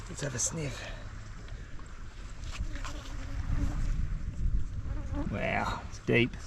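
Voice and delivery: quiet awe peering down the hole; hushed. Real, not acted. Peering into deep crab hole.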